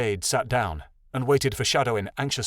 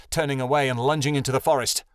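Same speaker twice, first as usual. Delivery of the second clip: usual speaking voice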